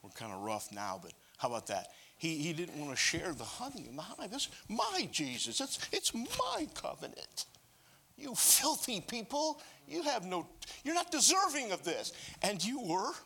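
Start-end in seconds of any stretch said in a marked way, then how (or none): 4.3-13.1 jealous Jonah. Acted. Preacher voices jealous Jonah.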